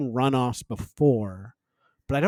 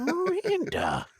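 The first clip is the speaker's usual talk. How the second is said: high, drawn-out impression voice; Steve; 5 dB quieter, slower than usual. Podcaster showing a co-host their Steve impression, high and drawling. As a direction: stretch the name out, pitched way up. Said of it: "That's my Steve impression".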